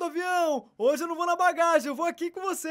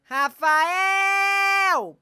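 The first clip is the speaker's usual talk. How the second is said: calling out loud; 5 semitones higher, 6 dB louder than usual. Acted. Mother calling son.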